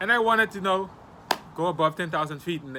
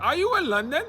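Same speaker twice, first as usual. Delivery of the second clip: higher-pitched mock incredulous question; retold surprise; 10 semitones higher, 4 dB louder than usual. Video caller surprised by the houses behind, retold incredulity.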